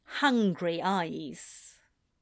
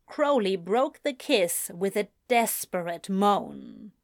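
usual speaking voice

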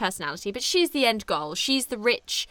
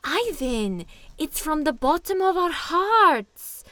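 higher, slow, patient mock correcting voice; 8 semitones higher, 4 dB louder, slower than usual. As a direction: gently set him straight, drawn out. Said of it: "And then [name] is like".

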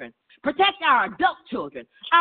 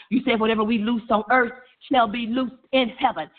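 ordinary delivery

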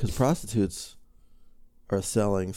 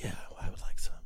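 soft unvoiced whisper, much quieter; low-key whispered order; 5 semitones lower, 9 dB quieter, faster than usual. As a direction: keep it hushed and discreet. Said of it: "can't be overly excited".

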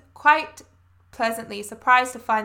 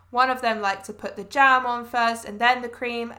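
ordinary delivery

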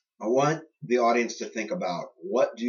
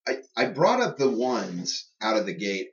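ordinary talk

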